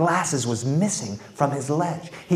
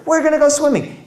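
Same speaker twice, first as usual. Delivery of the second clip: put-on much higher, loud, cheeky child voice; defiant kids; 12 semitones higher, 4 dB louder than usual. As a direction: talk back like a stubborn kid. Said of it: "the parents say".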